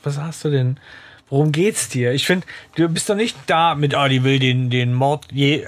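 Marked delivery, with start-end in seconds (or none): none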